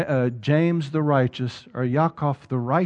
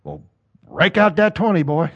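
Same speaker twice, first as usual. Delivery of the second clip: wife nudging; 2 semitones higher, 3 dB louder than usual. Retold or imitated. Wife pushes husband to give the twenty, mock bossy. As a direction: egg him on like a pushy wife. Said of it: "Mary's saying".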